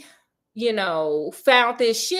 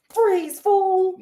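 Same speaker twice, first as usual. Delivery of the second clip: higher, punchier mock police order; hammed-up cop yell; 7 semitones higher than usual. Watson posing as a cop, hammed-up higher order. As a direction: strike a pose and bark it like a TV cop. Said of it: "Did you like my stance?".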